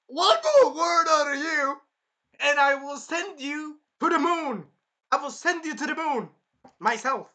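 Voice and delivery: loud, high-pitched theatrical ranting; hammed-up outraged warning; furious threat. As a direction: threaten her at full volume.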